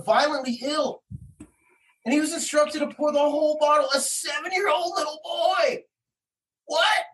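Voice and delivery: worked-up, high-pitched incredulous argument; real indignation. Real, not acted. Parent arguing over child told to poison, real indignation. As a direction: argue it hotly, pitch staying high.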